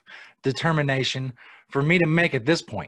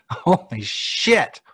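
laughing disbelief; 7 semitones higher, 5 dB louder, much slower than usual. Real, not acted. Laughing at pig implant headline.

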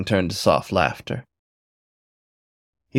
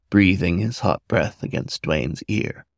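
ordinary delivery

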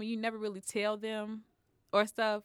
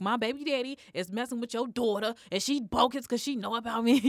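retold loud complaint; 5 semitones higher, 7 dB louder than usual. Complaining to the girl's mother.

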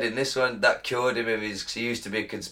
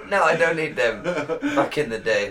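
higher, louder, laughing through the words; 7 semitones higher, 5 dB louder than usual. Real, not acted. Host laughingly answers co-host's hookers joke. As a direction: crack up while protesting. Joking about needing hookers.

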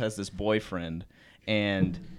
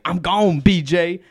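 mock angry shout; 6 semitones higher, 10 dB louder than usual. Boyfriend storming out.

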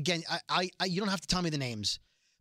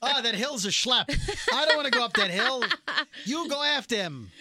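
kvetching cop; 6 semitones higher than usual. Acted. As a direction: whine it like a tired cop. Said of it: "And Goldstein's like".

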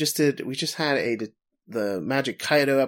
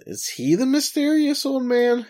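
high, louder mock Conan voice; mock kid Conan; 5 dB louder than usual. Retelling the episode's ending, a high mock Conan voice. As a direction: squeak it out like an excited kid detective.

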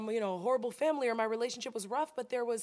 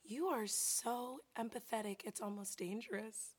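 softer, slow earnest therapist; soft earnest quote; 9 dB quieter than usual. Retold or imitated. Therapist warning her gently, soft and slow. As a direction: say it gently and slowly. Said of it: "She goes".

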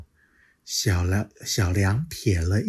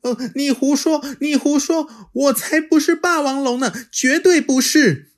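high, louder, quick denial; childlike protest; 6 dB louder than usual. Acted. Little dinosaur denying to the T-rex, high and indignant. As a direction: stamp your foot like a small child.

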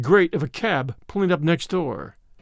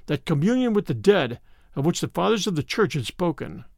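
ordinary delivery

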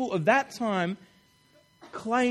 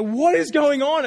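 high, loud, slow panicked question; freaked-out; 6 semitones higher, 7 dB louder, slower than usual. Retold or imitated. Women terrified by angels, high loud panicked question. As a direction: demand answers in alarm. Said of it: "This is like".

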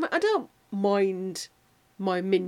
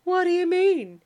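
high, loud mock surprise; imitating her sister's film line; quoting sister's high squeak; 10 semitones higher, 6 dB louder than usual. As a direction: pipe it up like a startled girl. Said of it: "Becca would go".

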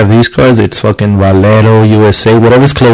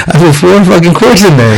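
higher-pitched retold outburst; mock exasperated confession; 7 semitones higher than usual. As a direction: pitch it up as you admit it to dad. Retold confession to dad, rising exasperation.

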